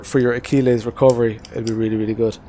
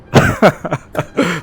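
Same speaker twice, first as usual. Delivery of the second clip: laughing; 5 semitones higher, 4 dB louder than usual. Real, not acted. Laughs at "excellent show.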